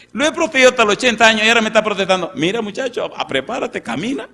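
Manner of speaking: exasperated God. Acted. Preacher voices an exasperated God.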